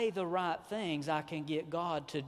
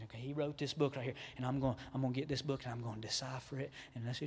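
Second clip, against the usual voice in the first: normal talking voice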